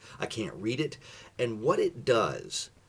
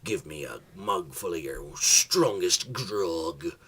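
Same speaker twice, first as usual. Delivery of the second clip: pirate voice; 5 semitones lower than usual. Acted. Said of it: "he says".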